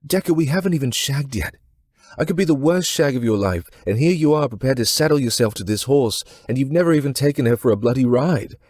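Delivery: high, loud, exasperated rant; fed-up woman scolding her suitor; irritated outburst. Acted. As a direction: let her boil over at him. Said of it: "irritation in her eyes".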